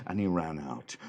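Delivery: ordinary delivery, nothing marked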